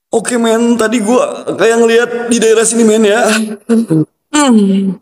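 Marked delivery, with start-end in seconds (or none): none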